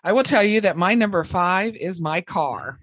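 ordinary talk

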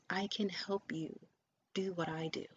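normal talking voice

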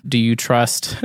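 ordinary talk

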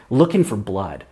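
normal talking voice

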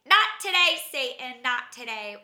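loud, high sassy refusal; sassy defiance. Retold or imitated. To Satan after the crash, sassy loud refusal. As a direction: tell the devil off with sass.

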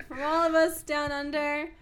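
big projected closing line; show sign-off. Real, not acted. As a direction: call out the farewell to listeners. Signing off the show.

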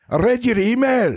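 high-pitched mock British accent; put-on British accent. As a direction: pipe it up in a silly British accent. Mock visit to UK listener.

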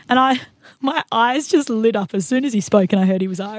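ordinary delivery

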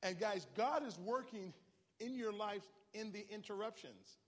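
loud, high, rising sermon climax; fiery pastor driving the sermon home; full-force preaching. Acted. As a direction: preach it hard to the congregation.

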